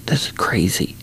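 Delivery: hushed, breathless disbelief; a stunned whisper under the breath; whispered to himself. Retold or imitated. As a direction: whisper it so only you hear.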